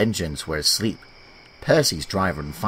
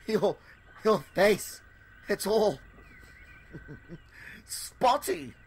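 high, soft, halting through giggles; giggling; 12 semitones higher, 8 dB quieter, slower than usual. Acted. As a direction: barely get it out for laughing. Another engine giggles at James.

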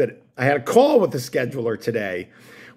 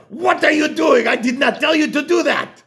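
freaked-out shout; 13 semitones higher than usual. Retold or imitated. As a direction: yell at him like she's panicking. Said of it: "she freaks out".

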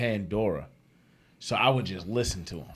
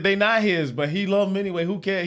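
defiant woman; 9 semitones higher than usual. Retold or imitated. Woman answering prank caller about kids, high defiant. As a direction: retort high and unbothered. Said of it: "She said".

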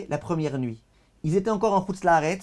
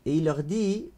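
loud, declaiming reading of the sacred text with translation; fervent text reading; 3 semitones higher, 6 dB louder than usual. Acted. Teacher reading the heavenly voice's message to students, declaiming. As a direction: proclaim the heavenly voice's words to the class.

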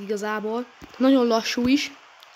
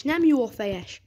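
normal talking voice

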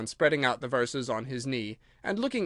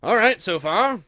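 high, louder fussy king; pompous king; 6 semitones higher, 8 dB louder than usual. Acted. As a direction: approve it like a silly king. King pleased.

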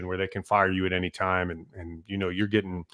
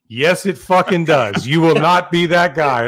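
captain refusing, raised voice; emphatic refusal; 5 semitones higher, 8 dB louder than usual. Retold or imitated. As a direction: shut the rookie down hard.

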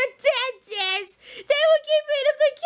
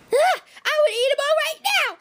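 ordinary delivery

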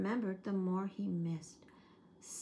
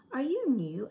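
curious splotchy bear; 5 semitones higher, 7 dB louder than usual. Acted. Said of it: "asked the strange, splotchy bear".

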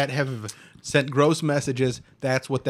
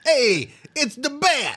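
high, slow Brooklyn goon yell; hammed-up Brooklyn alarm call; Brooklyn henchman accent; 12 semitones higher, 4 dB louder, slower than usual. Henchman spotting Batman, high drawn-out Brooklyn accent. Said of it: "In a Boston accent".

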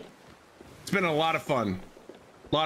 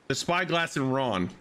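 usual speaking voice

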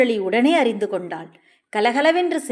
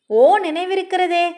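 high, raised-voice reply; calling out; 7 semitones higher, 4 dB louder than usual. A narrator's reading. Cousin answering that he remembers, calling out. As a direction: sing out the answer on a high pitch. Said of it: "இறைந்து சொல்லிவிட்டு".

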